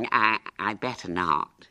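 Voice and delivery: put-on slow, hesitant boy; anxious. A narrator's reading. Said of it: "he said anxiously".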